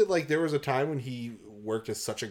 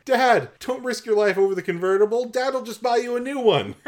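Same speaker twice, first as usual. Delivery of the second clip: high, loud melodramatic whine; over-the-top begging, close to laughing; mock pleading daughter; 12 semitones higher, 7 dB louder than usual. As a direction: ham up a spoiled daughter's plea. Mock daughter's plea about convertible.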